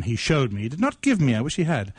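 ordinary delivery

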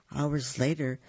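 usual speaking voice

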